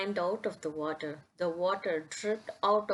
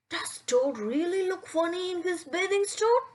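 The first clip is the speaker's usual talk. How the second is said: high squeaky lizard voice; lizards asking; 11 semitones higher than usual. Acted. Lizards ask about Toad, high voice. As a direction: go way up for the little lizards. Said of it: "they asked".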